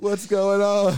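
high loud panicked cry; panicked kid. Retold or imitated. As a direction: yelp it like a scared kid.

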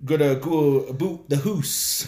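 lightly hammed-up thick Canadian accent. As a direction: joke Canadian accent, stretch the ou into oo. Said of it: "a very thick Canadian accent".

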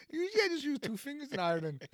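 high-pitched mock Irish lilt; Irish accent. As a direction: put on a jokey, high Irish brogue. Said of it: "Can you do an Irish accent".

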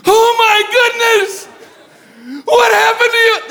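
high, loud freaked-out exclaim; a panicked nurse at the desk; alarmed receptionist. Retold or imitated. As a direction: shriek it like she's panicking. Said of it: "she went".

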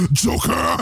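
raspy, high mock battle cry; frenzied pursuit cry. Bruce sprints after Joker, frenzied raspy cry. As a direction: rasp the name out as if charging.

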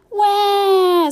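high piercing wail; loud child's scream. A narrator's reading. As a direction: wail like a furious little girl. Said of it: "screamed Gertie".

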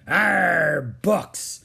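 loud, drawn-out pirate growl. A narrator's reading. Captain Bones hearing of books, disgusted growl. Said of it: "growled Captain Bones".

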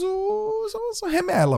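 a squeaky mock voice calling them remela; mocking high voice. Mocking voice for non-federated.

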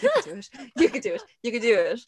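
high squeaky urging, easing down; self pep talk. Retold or imitated. Limbo child urging self through, high mock encouragement. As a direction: cheer yourself on in mock strain.

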